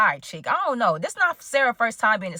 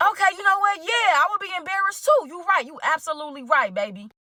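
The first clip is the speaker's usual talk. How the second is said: relieved sweet talk; 8 semitones higher than usual. Retold or imitated. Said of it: "I'm like".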